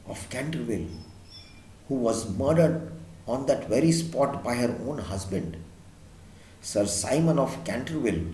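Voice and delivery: low, soft, grave housekeeper. A narrator's reading. Housekeeper quietly tells Missus Otis about the stain, low voice. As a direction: keep it low, as if telling a dark secret. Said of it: "again in a very low voice".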